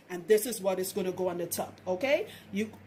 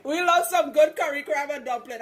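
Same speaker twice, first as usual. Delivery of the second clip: fuller Trinidadian accent, louder and lifted; hammed-up Trinidadian accent, pitched up and loud; 5 semitones higher, 6 dB louder than usual. Shown as a demonstration. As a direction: lean into the Trini accent with relish after a laugh. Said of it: "I say that in my Trinidadian accent".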